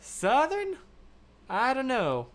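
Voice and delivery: high Southern American twang, questioning; exaggerated American accent. Shown as a demonstration. Streamer unsure of their American accent, high mock Southern twang. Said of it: "This is my American accent".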